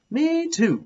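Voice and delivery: high, eager agreement; eager kid voice. A narrator's reading. Willie eagerly agreeing in class. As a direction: pipe up like an excited kid.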